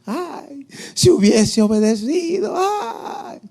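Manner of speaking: high drawn-out mock wail of regret; hammed-up high cries of anguish; screaming in hell. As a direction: wail like an acted tormented soul. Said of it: "Están gritando".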